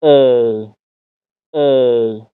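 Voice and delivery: ای drawn out as English "ay"; long A diphthong. Shown as a demonstration. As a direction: glide slowly from E to I on ای.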